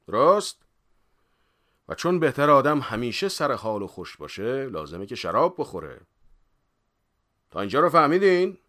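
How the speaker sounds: loud, high mock schoolmaster; emphatic, declaimed lesson with punched check questions; firm-voiced lecturer. A narrator's reading. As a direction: lecture a rowdy class with great authority. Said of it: "با صدای محکمی گفت".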